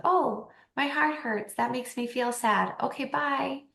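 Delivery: innocently accepting a breakup; mock childlike sadness. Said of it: "I'd be like".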